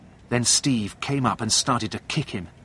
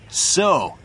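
higher, drawn-out taunt; taunting challenge; 7 semitones higher, 4 dB louder than usual. A narrator's reading. Steve pins John to the wall, taunting. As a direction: open a fight with one taunting word. Bully starts a fight.

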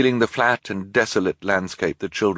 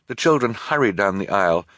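plain reading voice

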